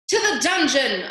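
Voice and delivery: loud, high cry from the crowd. A narrator's reading. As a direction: call for punishment. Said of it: "a voice cried out".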